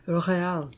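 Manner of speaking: "Real" with a rolled, posh-sounding R, stretched out; mock aristocratic R. Shown as a demonstration. Streamer copying the manager's word, mock aristocratic R. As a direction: say "Real" like the snooty manager, rolling the R. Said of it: "pronounces the R with a mock aristocratic accent".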